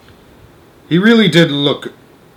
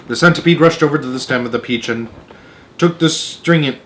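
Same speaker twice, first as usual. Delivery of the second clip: ordinary delivery, nothing marked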